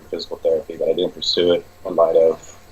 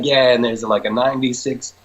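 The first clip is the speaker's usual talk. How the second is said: quoting the client; 6 semitones higher than usual. Retold or imitated. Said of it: "He's like".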